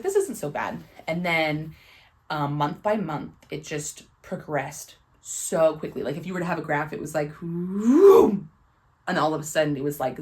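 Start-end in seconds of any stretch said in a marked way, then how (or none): 0.0-0.8 relieved inner voice. Retold or imitated. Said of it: "I was like". 7.3-8.5 whoosh sound. Retold or imitated. Graph shooting up.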